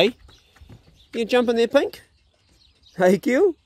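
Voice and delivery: calling the dogs up high, rising. Real, not acted. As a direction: sing the names high to coax them in.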